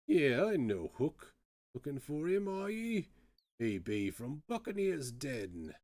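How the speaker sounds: lilting, swooping pirate voice; salty Cockney privateer, pitch leaping up. Acted. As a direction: play an old Cockney sailor telling his past. Privateer speaks of his acquaintance.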